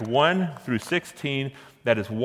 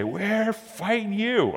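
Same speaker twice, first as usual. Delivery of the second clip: mock war cry; 11 semitones higher, much slower than usual. Soldiers' war cry retold.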